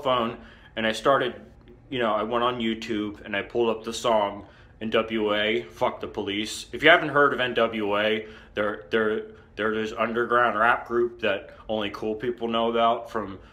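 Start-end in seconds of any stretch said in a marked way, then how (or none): none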